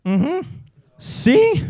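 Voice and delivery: short hum, then high rising "si"; pretend Spaniard. Playing Spanish for the man in the lift, innocent high agreement. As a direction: play along as a clueless Spaniard. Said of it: "This fucker thinks I'm Spanish".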